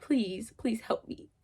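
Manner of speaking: whispered, pleading please; a whispered plea for help; whispered prayer. Retold or imitated. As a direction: breathe a scared prayer to God. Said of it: "crying to God, like".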